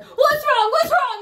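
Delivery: high, loud, frantic "what's wrong"; alarmed shout. Retold or imitated. Woman rushing up at dawn, frantic high shout.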